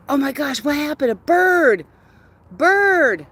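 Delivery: high, loud startled cries; real alarmed shouts at a bird; excited alarm. Real, not acted. Dog chased bird, owner alarmed.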